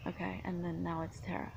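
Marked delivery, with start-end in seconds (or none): 1.2-1.5 "Tara" softened into TERR-uh; misread pronunciation. Shown as a demonstration. Explaining how the actress misread it, TERR-uh pronunciation. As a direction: say Tara with an E, quietly. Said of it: "Probably read it like this".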